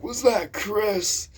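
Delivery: loud, high, drawn-out old-teacher imitation; Mister Will. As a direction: interrogate as an irate old teacher, slowly. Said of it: "He's like".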